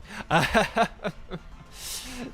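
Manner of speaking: short rising laugh at own joke. Real, not acted. Streamer laughs after joking about a viewer's name, short laugh.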